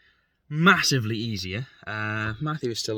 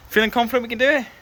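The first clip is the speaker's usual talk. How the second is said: upbeat question; 11 semitones higher, 6 dB louder, faster than usual. Real, not acted. Cheery question to Matthew.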